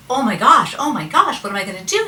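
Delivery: loud, high-pitched mock panic; acted distress. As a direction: cry out in hammed-up despair. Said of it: "he's crying".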